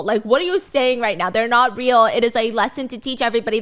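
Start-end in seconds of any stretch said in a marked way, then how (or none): none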